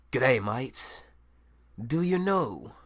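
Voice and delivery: a comic mock-Australian show host; Australian accent. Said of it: "So I did it in an Australian accent".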